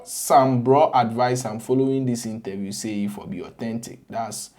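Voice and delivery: ordinary talk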